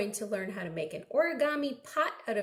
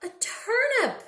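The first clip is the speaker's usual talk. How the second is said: high, louder answer; excited reveal; 12 semitones higher, 4 dB louder than usual. Acted. Revealing the answer to children, excited. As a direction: announce it with delight.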